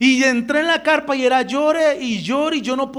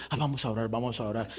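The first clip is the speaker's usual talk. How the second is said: low, very soft imitation; sleepy-quiet pastor; 12 semitones lower, 11 dB quieter, much faster than usual. Roused pastor agreeing to pray, low soft murmur. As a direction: answer low and hushed, half roused.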